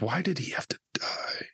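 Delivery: hushed, grieving whisper; whispered lament for a dead character. Retold or imitated. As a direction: breathe the question out, heartbroken. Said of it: "And you're like".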